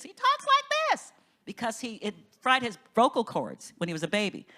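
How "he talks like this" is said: very high, slow, strained thin voice; George Carver's voice. Retold or imitated. Preacher imitating Carver's voice for the congregation, high and thin. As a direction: imitate his high damaged voice, slowly. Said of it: "he talked like this".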